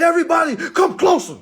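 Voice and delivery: high, loud call to the group. Retold or imitated. To the huddled officers, a high loud call. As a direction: summon them all over. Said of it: "I said".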